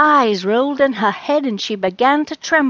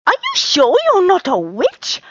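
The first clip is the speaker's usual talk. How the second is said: cat voice; 9 semitones higher, 5 dB louder than usual. Acted. Charlie's high cat voice.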